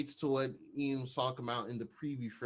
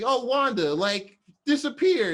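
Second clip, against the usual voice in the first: high, loud, slow goofy Vision voice; hammed-up tipsy android calling for Wanda; mock drunk Vision; 10 semitones higher, 7 dB louder, slower than usual. Retelling a show to a friend, a loud slow goofy Vision.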